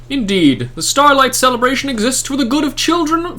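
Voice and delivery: lofty, theatrical holy man addressing a scholar; grand saintly NPC. Acted. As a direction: proclaim it like a pompous festive saint. Saint explains the lesson.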